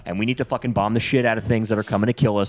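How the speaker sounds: usual speaking voice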